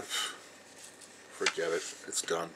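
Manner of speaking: breathed out slowly, almost no voice; a slow, disgusted whispered dismissal; resigned whisper. Real, not acted. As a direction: let it die in a whisper. Giving up on America.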